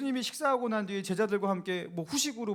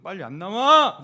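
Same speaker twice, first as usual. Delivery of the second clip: louder, playful sisterly shout; mock scolding yell; 8 dB louder, slower than usual. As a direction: snap at the little sister in fun. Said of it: "옆구리 쿡 찌르면서". (언니가 동생에게 장난스럽게 소리치며)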